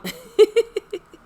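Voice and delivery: loud squealing laugh; a sudden high giggle; high burst of laughter. Real, not acted. Laughing at own pigtails look.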